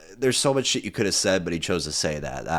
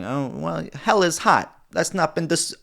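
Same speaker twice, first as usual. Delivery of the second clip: much higher put-on voice for a mobster's quote; quoting the mobster in a high mock voice; Paulie voice; 11 semitones higher than usual. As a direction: pitch way up to imitate him deadpan. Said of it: "he's like".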